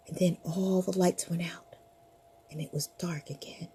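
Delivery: hushed storyteller whisper; fading spooky whisper. A narrator's reading. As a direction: whisper it into the dark. Narrator whispers the ending.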